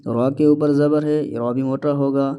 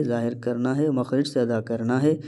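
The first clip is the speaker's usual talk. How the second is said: ordinary delivery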